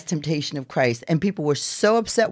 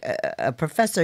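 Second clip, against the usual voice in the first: ordinary talk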